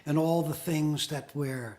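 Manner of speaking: muffled, distant, like under water; bad connection, sound swallowed; underwater. Real, not acted. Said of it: "Sounds like you're underwater".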